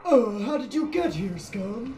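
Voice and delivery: mock menacing orc. Gamer voicing an orc sneering at an intruder. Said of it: "much more stupid sounding voice".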